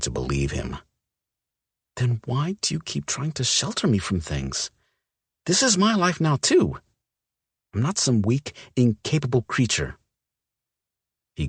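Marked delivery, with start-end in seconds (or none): 2.0-10.0 a woman refusing to be coddled; indignant young woman. Acted. Olivia's figure voice, indignant.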